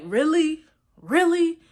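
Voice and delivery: mock snide disbelief; rude disbelief. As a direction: say it like she can't believe it's her. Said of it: "it completely rubbed me the wrong way".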